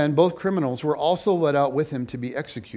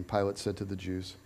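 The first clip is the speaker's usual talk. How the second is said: plain reading voice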